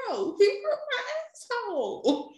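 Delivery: high laughing refusal; laughing protest. Retold or imitated. New mother refusing her baby, laughing high. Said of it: "I'm like".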